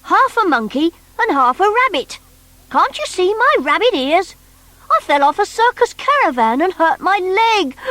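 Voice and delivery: high, funny little creature voice; bunkie's voice. Acted. Bunkie telling Noddy about himself, high creature voice. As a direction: squeak it out like a hurt half-rabbit.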